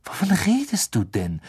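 high, indignant question; angry. A narrator's reading. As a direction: demand an explanation with mild anger. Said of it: "sagte Harry zornig".

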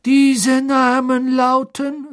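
laut und schneidend, langsam; scharf. Acted. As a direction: ungeduldig unterbrechen. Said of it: "sagte Mister Crouch scharf". (Crouch schneidet Karkaroff scharf das Wort ab)

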